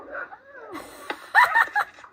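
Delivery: high cackling laugh; real high-pitched laugh at the clip; burst of laughter. Real, not acted. Reacts to played clip.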